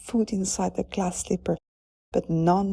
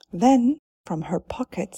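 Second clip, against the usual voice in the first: ordinary delivery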